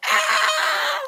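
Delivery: high-pitched squeal of a thrilled concertgoer; excited fan scream. Retold or imitated. Retelling the concert moment to viewers, a high excited squeal. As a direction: shriek with joy like at the show. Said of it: "I went absolutely batshit crazy".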